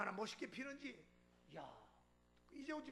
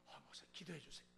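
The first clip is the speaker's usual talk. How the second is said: hushed request; 8 semitones lower, 9 dB quieter than usual. Retold or imitated. Deacon quietly asks for prayer.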